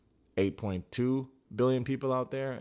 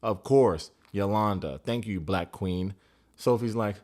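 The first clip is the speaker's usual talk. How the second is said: ordinary talk, nothing marked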